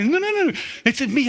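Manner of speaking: very high, urgent calling of dad; excited teen. Retold or imitated. Son running upstairs to tell his father big news, very high and excited. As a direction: burst in breathless with news, pitch way up.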